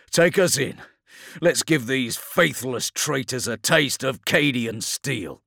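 high, projected command; rally the crew as you give the order; rousing battle order. Acted. Captain ordering driver into battle, rousing battle order.

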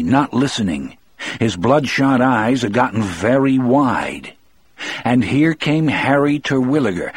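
ordinary delivery

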